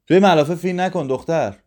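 put-on Mansour snaps loud and high at the girl; shouting. A narrator's reading. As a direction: call out sharply across the room. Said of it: "منصور داد زد".

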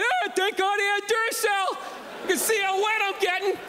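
cheesy commercial actor, grateful for his flashlight; mock commercial guy. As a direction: play the grateful guy in an old battery ad. Mocks old commercial guy in rain.

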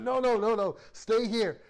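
half-playful mock alarm, wave him back; loud no no no; coworker stopping him. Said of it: "He said".

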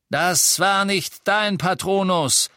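loud, high, slow contradiction. A narrator's reading. Death Eater disputing the innkeeper's claim, loud challenge. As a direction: call him out slowly and loudly.

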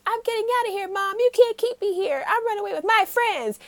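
high, pleading defiant son; Jimin defiant. Retold or imitated. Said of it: "And he's like".

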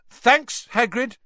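loud, high and pointed; loudly. A narrator's reading. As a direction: say it loud to cut him off. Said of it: "said Harry loudly".

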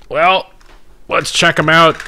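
a put-on showman teasing the question; hammed-up show-off voice. Answering a viewer about boxers, hammed-up showman voice. Showing off shorts on stream.

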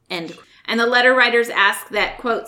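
ordinary talk, nothing marked

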